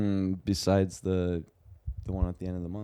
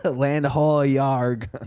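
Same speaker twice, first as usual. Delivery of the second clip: higher, hammed pirate surfer call; 4 semitones higher, much slower than usual. Pirate surfer sighting land, lightly hammed-up. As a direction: sing out land ho like a beach pirate. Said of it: "a pirate surfer".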